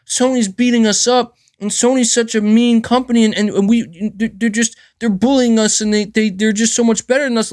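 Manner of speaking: mock aggrieved corporate griping, voice pushed up; mock Microsoft complaint. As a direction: mimic a big company pleading that it's being bullied. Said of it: "Microsoft coming out here and saying".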